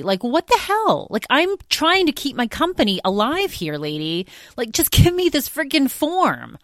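high-pitched rant at a rude clerk; exasperated rant. Retold or imitated. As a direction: vent it up high, fed up and pleading. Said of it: "I'm like".

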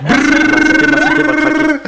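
high buzzing lip-trill brrr; silly brrr noise. Pretend. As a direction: blow a goofy high brrr. Silly noise after laughter.

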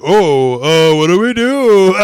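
high, loud, drawn-out mock dithering; hammed-up flustered cry; mock panic. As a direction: wail it like a comically helpless crowd. Acting out a flustered crowd.

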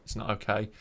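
usual speaking voice

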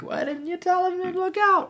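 very high squawking dame; panto dame voice. Retold or imitated. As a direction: shriek it like an outraged panto dame. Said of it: "and then the dame's like".

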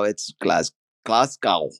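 "Glasgow" slowly as GLAHS-kow; "Glasgow" broken in two, slow, ending like "cow"; German-style pronunciation. Shown as a demonstration. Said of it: "and German".

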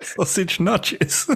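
high, slow, laughing query; amused disbelief. Real, not acted. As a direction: repeat it high through real laughter. Laughing at the unfamiliar name.

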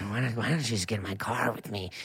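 low, hushed, creepy coaxing; low, quiet creep at a party; creepy party-guy character. Pretend. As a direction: murmur a shady invitation, low and soft. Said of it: "What character is that?".

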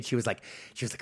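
usual speaking voice

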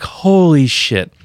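slow, loud, high holy shit; awed exclamation. Retold or imitated. Reliving the lyrics' message, a slow, loud awestruck exclamation. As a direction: draw it out in shock.

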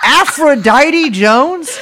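laughing. Real, not acted. Delighted at a funny name.

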